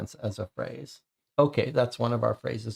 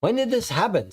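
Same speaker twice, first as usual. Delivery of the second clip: shocked; 9 semitones higher than usual. Acted. Vampire shocked by news.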